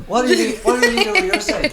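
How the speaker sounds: a hint of a posh waiter, high; lightly voiced waiter, pitched high; mock waiter. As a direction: serve the guest playfully. Serving scones like a waiter.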